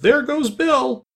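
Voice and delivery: high, drawn-out exclamation; chorus cry. A narrator's reading. The crowd watching Bill fly up, crying out. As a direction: call it out long and high. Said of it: "was a general chorus of".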